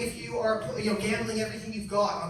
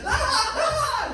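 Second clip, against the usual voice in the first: high-pitched cartoon wail; hammed-up cries of a fallen egg; mock screaming; 4 dB louder, much slower than usual. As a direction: wail "ah" high, in comic agony. Humpty acted screaming on ground.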